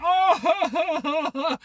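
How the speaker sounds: high imitating wail; a short hammed-up wailing Oh; mock wailing. Cashiers moved by the roses, mock wail. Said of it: "like, wailing".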